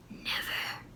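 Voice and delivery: a voiceless defiant whisper; whispered thought. Acted. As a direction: hiss it under your breath. Boy's defiant silent thought.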